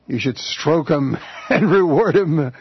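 slow, drawn-out words with chuckles; laughing. Real, not acted. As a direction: let a laugh break into the line. Host jokes about rewarding colleague.

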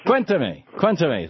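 Cuéntame repeated with stress on CUÉN, pitch up; Cuéntame carefully copied with Spanish stress; corrected Spanish pronunciation. Shown as a demonstration. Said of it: "It's just the accent on the".

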